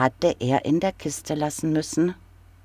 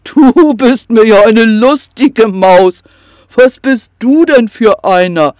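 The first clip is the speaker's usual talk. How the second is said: loud, higher bellowing troll voice; bawling troll; 5 semitones higher, 9 dB louder than usual. Acted. Troll bawls at the little kobold, loud and jolly. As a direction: roar it with hearty amusement. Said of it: "gröhlte er".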